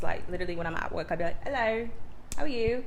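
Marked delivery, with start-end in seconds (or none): 1.4-2.8 put-on British accent. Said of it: "I accidentally talk to people in accents".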